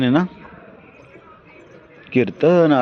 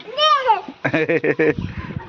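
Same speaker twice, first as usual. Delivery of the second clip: high laugh. Real, not acted. Burst of laughter.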